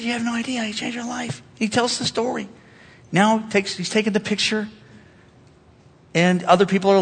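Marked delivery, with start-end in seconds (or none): 0.0-1.4 soft, breath-held retelling of heartfelt thanks; hushed gratitude. Retold or imitated. Man telling his story to the pastor, hushed and grateful. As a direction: say it very softly, imitating a moved man.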